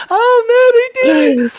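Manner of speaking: high, loud hammed-up sob of joy; mock crying. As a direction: cry like Shark Tank got you good. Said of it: "I'm emotional. I cry at Shark Tank".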